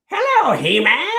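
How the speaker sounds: high, raspy cartoon villain greeting; Skeletor voice. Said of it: "do your Skeletor voice".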